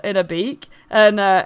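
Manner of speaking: usual speaking voice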